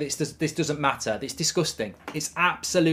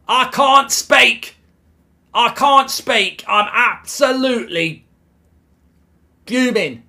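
louder, higher and slower, dragged out in disbelief; exasperated yell; 6 semitones higher, 7 dB louder than usual. Real, not acted. Fan shouting at the screen about the fourth goal, exasperated yell. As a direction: spell out each word in a furious yell.

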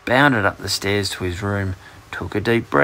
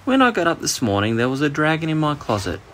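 higher-pitched, careful confession; 6 semitones higher, faster than usual. A narrator's reading. Boy tells his teacher about the dragon, carefully. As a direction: break the news carefully, voice raised in pitch. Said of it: "said Jonathan carefully".

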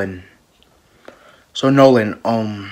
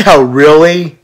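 loud, high, laughing disbelief; loud rising amazement; amazed reaction; 6 semitones higher, 9 dB louder, slower than usual. Acted. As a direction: react with big, high-pitched surprise. Excited reaction to gym plan.

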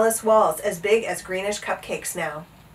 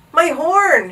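loud high exclamation; gulped dismay; 8 semitones higher, 8 dB louder than usual. A narrator's reading. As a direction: cry out in dismay. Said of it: "he gulped".

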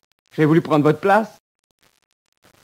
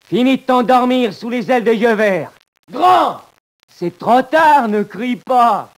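1 slow menace; 4 semitones higher, 4 dB louder, slower than usual. Acted. Strangling his cellmate.

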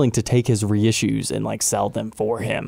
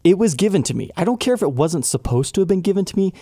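higher-pitched, insistent objection; indignant; 7 semitones higher, faster than usual. Acted. Objecting to PayPal's excuse about the extension, indignant. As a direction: argue the point, pushing the pitch up. Argues against PayPal's reason.

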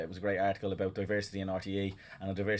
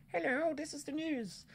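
put-on high, slow, plummy old BBC announcer; mock the stiff early BBC news voice; false posh BBC accent; 6 dB quieter, slower than usual. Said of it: "this very false accent that alienated people".

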